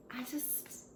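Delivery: faltering soft whisper. Acted. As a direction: breathe it out, barely there. Overwhelmed, needs to be alone.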